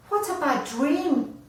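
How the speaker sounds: high, loud startled cry; wombat yells. Acted. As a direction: yell it out of a bad dream.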